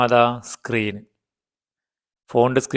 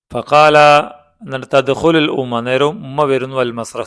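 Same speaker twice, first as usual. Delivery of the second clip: usual speaking voice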